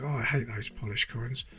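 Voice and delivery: normal talking voice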